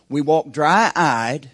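dry-eyed stretched very slow and loud; preacher hammering dry-eyed; emphatic preaching. Real, not acted. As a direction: drag out dry-eyed to shame them. Preaching at full force.

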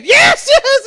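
very high, loud squealed yes; hammed-up delighted scream; overjoyed acceptance. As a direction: imitate an ecstatic fiancée shrieking. Acts fiancée accepting and tackling again.

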